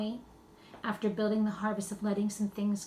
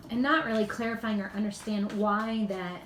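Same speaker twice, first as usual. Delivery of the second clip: usual speaking voice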